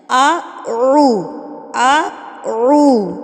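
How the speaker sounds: اعو stretched very slowly, ain held long; drawn-out recitation. Shown as a demonstration. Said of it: "ٹھیک ہے؟". (اعو کو بہت آہستہ کھینچ کر)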